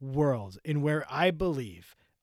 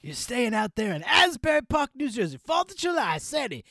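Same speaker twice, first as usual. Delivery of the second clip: higher, hyped Jersey rocker; 6 semitones higher than usual. Pretend. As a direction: call out like on stage. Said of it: "I'm doing a whole voice".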